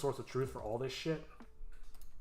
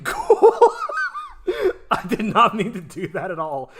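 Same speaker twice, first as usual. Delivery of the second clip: loud, higher, laughing through the words; delighted laughing; 10 semitones higher, 13 dB louder than usual. Real, not acted. Editor surprised by accidental cool effect, laughing loudly. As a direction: crack up loudly at a happy accident.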